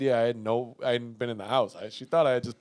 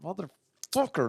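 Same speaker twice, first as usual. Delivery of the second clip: muttered curse; 7 dB quieter, slower than usual. Retold or imitated. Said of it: "between my teeth, I'm just cursing".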